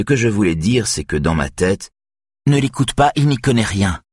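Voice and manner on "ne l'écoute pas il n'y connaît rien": cutting in, higher and annoyed; irritated. A narrator's reading. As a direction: snap in irritated, interrupting. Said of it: "l'interrompt Annette d'un ton agacé". (Annette coupe la parole, agacée)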